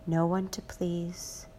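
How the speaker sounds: normal talking voice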